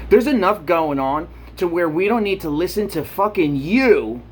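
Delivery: ordinary talk, nothing marked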